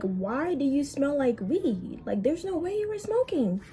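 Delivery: mock disbelief. To the ex walking away, mock-incredulous high questioning. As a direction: stretch it out like you can't believe it.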